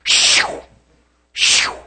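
repeated breathy shoo sounds; preacher imitating a fake Holy Ghost manifestation; mock spirit shoos. Shown as a demonstration. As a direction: blast out shoo twice. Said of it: "I'm doing this for a reason".

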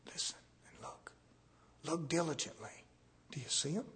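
very soft, part-whispered urging; hushed. Real, not acted. Preacher asking congregation to listen for spirits, hushed. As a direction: drop to a near-whisper, as if listening out.

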